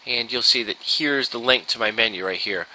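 ordinary talk, nothing marked

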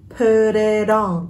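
"put it on" slowly linked into one word; slow demonstration linking "put it on". Shown as a demonstration. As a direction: slowly join "put it on" as "pu-ri-don". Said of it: "đọc nói ha".

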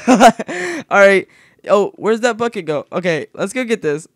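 usual speaking voice

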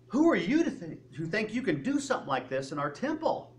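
high, indignant challenge, hardest at the start; confrontive. Retold or imitated. Temple crowd coming up to Jesus over the overturned tables, confrontive. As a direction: confront him as an outraged accuser. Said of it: "this is a confrontive thing".

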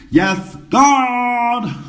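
a camp triumphant cry; exultant high shout. Real, not acted. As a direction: shriek it way up high and loud. Exclaiming over nose hair.

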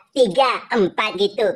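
ordinary delivery

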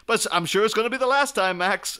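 high, loud, desperate-to-please mock mayor; hammed-up fawning politician; eager mayor. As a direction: gush it to win him over. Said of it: "And then the mayor's like".

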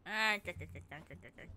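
loud "ah" breaking into rapid clicks; mock cackle falling away low; stuttering croak. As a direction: rattle off a throaty k-k-k. Imitated rattling noise.

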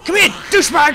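high, loud shouted taunt; combat taunt. Real, not acted. Player going after an enemy, shouted taunt. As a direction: yell it at your target.

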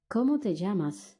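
usual speaking voice

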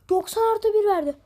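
loud, high indignant cry; outraged shout. Real, not acted. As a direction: shout it in disbelief. Outrage at added minute.

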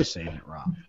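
low, whispery comeback; pointed aside under the breath; hushed retort. Pretend. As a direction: drop to a near whisper to correct them. Mock retort to those correcting him.

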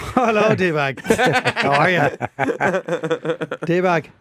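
put-on Irish-accented [name] greeting D-Bag, drawn out and laughing; a slow, laughing, mock-friendly Irish hello. [name] greets D-Bag with a slow, laughing Irish hello. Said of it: "Hey, [name], D-Bag's back".

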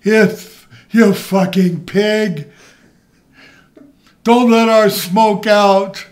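high, loud, slow mock rage; Sheik yelling. Sheik scolding her over the smoke, imitated yell. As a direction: drag out the furious insult. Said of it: "the Sheik is so funny".

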